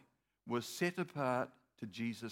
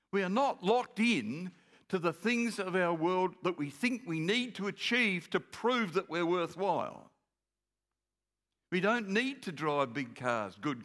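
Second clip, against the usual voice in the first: louder, building preacher's proclamation; full preaching; 5 semitones higher, 5 dB louder, slower than usual. Acted. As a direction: proclaim each line to the church.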